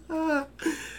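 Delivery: light, high-pitched giggle; high laugh. Real, not acted. Host laughing after cheering about smiles, high giggle. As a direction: let out a high, happy laugh.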